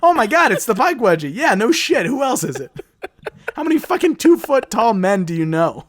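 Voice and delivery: loud, high mock shock, then exasperation; mock surprised character. Mocking the story's hero, loud fake amazement. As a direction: ham up a dim hero's fake surprise. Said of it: "he's like".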